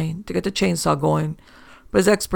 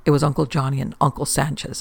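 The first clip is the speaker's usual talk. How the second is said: plain reading voice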